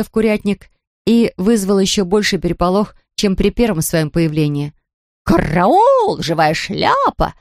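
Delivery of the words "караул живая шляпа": drawn-out henhouse panic; cackling hens. A narrator's reading. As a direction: cluck in alarm like a hen. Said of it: "раскудахтались куры".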